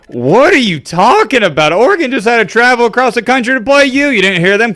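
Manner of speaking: can't believe this excuse; incredulous. Real, not acted. Mocking the coach's excuse.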